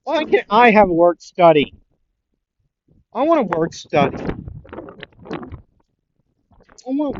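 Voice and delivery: loud, high whining; petulant protest to the room; whiny complaint. Retold or imitated. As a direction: whine like a child denied a treat. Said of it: "Mirko would say".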